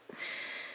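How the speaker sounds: a short, soft, breathy laugh; chuckle, grudgingly impressed; quiet impressed chuckle. Acted. Villain sees it still plays after a bullet, quiet chuckle.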